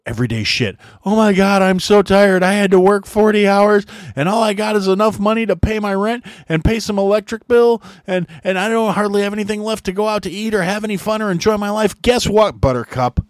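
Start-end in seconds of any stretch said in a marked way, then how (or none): 1.0-12.0 whiny complaining. Retold or imitated. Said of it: "is complaining about".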